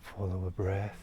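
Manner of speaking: calm, soft meditation voice with long pauses; guided meditation. Real, not acted. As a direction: guide listeners gently into stillness, leaving long silences. Leads a guided nature meditation.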